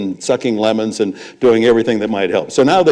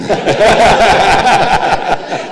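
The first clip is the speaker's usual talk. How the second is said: loud laugh; 10 semitones higher, 6 dB louder than usual. Real, not acted. Laughs at Al's punchline.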